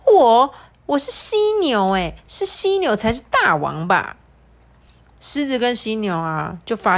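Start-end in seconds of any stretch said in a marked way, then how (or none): 0.1-4.2 indignant rhino voice. Acted. Rhino argues with lion.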